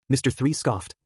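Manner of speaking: usual speaking voice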